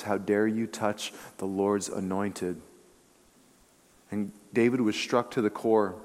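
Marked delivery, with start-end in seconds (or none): 0.0-2.6 low, soft, slow acted reproach; hushed rebuke. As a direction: rebuke gravely under your voice. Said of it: "the Lord struck his heart and says".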